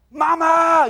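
put-on high, loud panicked yell of a lost kid; desperate shout. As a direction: belt it out like a lost child. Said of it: "you get desperate, you kind of start going".

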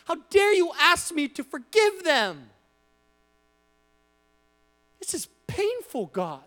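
broken, pleading prayer of hurt; anguished plea to God. Acted. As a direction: pour out a wounded heart to God. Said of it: "picture us looking at God and saying".

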